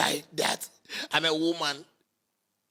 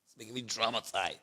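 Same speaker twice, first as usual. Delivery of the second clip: low, soft fading repeat; quiet; 6 semitones lower, 9 dB quieter than usual. Real, not acted. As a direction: let it drop to a near murmur. Repeats lament, then fades.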